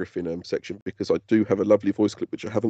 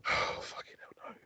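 out of breath; 16 dB quieter, slower than usual. Real, not acted. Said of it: "I'm out of breath as it is".

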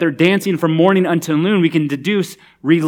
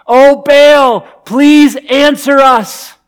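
loud, higher pleading cry, slow on please; 8 semitones higher, 8 dB louder, much slower than usual. Retold or imitated. Prophets pleading with Baal, loud desperate cry. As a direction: beg the idol loudly, drawn out. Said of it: "crying out".

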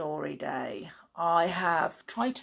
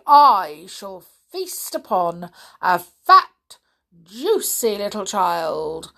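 greedy enormous crocodile; 7 dB louder than usual. Acted. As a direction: gloat like a hungry crocodile. Story figure voice.

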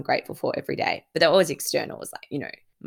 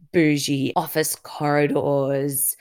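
ordinary talk

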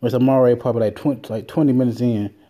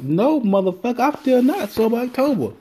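own retort; 11 semitones higher than usual. Retold or imitated.

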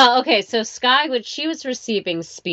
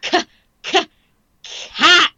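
high, exaggerated "K-, k-, cat"; sounding it out; 10 semitones higher, 4 dB louder, slower than usual. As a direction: stretch out the K sounds. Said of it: "And then she".

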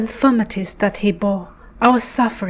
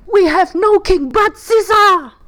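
high, loud defiant cry; chief priests answer; 9 semitones higher, 5 dB louder than usual. Acted. Chief priests answering Pilate, loud high defiant shout. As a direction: fling it back at Pilate.

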